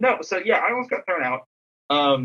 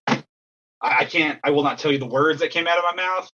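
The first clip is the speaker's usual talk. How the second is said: normal talking voice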